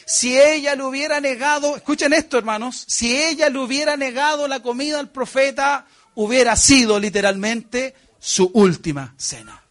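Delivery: loud, high, driving sermon voice; fervent preaching. Acted. Preacher urging his brothers, loud and high. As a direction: preach it to the back row.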